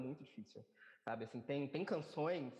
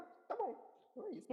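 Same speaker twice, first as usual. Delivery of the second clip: sing-song high okay; high fake-cheerful. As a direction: hide the hurt with a high chirp. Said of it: "Eu falei".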